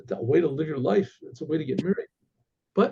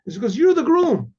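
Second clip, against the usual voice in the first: put-on louder, higher punchline of a rowdy drunk; spring the joke on him with a shout; gleeful reveal; 6 semitones higher, 5 dB louder than usual. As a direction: drunk ruffian crowing the punchline at the scholar.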